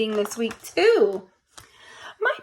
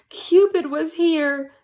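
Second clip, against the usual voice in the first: plain reading voice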